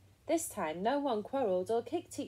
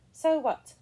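loud, high scoffing; siblings' raised, cheeky jeer; dismissive retort; 7 semitones higher, 10 dB louder than usual. A narrator's reading. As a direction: throw it back, unimpressed. Siblings scoff at her counting.

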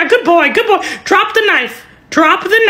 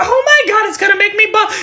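usual speaking voice

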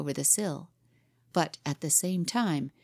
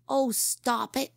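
put-on higher boy's voice, slow and fed up; annoyed boy captain; 6 semitones higher, slower than usual. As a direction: brush off a silly pal.

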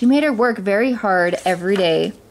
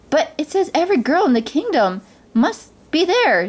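higher, pleading insistence; hopeful protest; 7 semitones higher than usual. A narrator's reading. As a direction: plead your case in a raised pitch. Cinderella protests to her stepmother.

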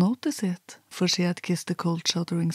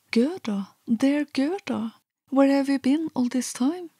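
rejoicing cry; 7 semitones higher than usual. A narrator's reading. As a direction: cry out with joy, higher. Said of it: "cried rejoicingly".

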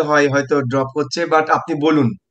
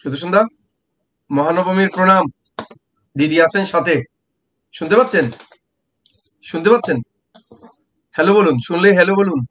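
raised voice calling to a bad line; calling out loudly; 4 semitones higher, 3 dB louder, faster than usual. Real, not acted. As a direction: call out to be heard. Calling out to remote guest.

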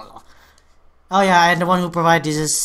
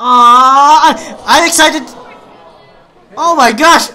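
higher and louder, gushing; excited squeal; 7 semitones higher, 10 dB louder, slower than usual. Real, not acted. Fan gushing at the teaser, excited squeal.

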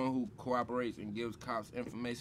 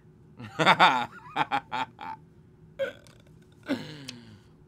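loud laugh, a bit high; 4 semitones higher, 12 dB louder than usual. Real, not acted. Rapper cracking up over po meaning poor.

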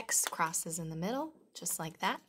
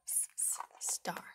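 whispering; 7 dB quieter than usual. Acted. Whispered letter sound.

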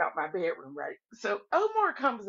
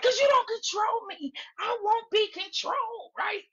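high-pitched, worked-up imitation; mock defiant protest; 11 semitones higher than usual. Woman telling the panel she answers to nobody, mock defiant outburst. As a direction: throw her defiance out high and insistent. Retold woman defies Slim.

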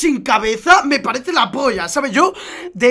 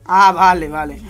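ordinary delivery

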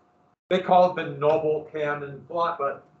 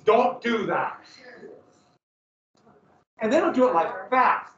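ordinary talk, nothing marked

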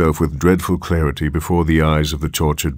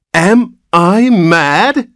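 slow, high, loud outcry of disbelief; thundering rage; 13 semitones higher, 6 dB louder, much slower than usual. A narrator's reading. Horrified father to the nurse, thundering in rage. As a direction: thunder it, stretching every word. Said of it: "thundered Mister Button, his terror resolving into rage".

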